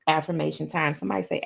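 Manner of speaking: usual speaking voice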